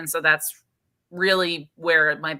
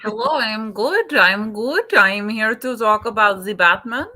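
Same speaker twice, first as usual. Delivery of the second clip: playful put-on Scandinavian accent; mock Scandinavian accent, a bit higher; 4 semitones higher, 6 dB louder than usual. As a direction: greet them in a lightly hammed Scandinavian accent. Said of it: "Why did we turn Russian".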